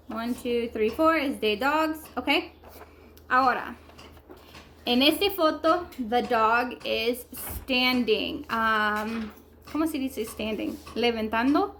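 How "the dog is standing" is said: very slow teaching sentence; slow, stretched learner demonstration; slow modelling. Shown as a demonstration. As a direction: draw out "the dog is standing" word by word. Teacher models English sentence slowly.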